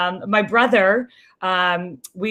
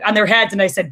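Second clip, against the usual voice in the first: normal talking voice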